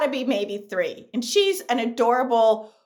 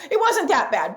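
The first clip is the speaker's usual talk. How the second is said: ordinary talk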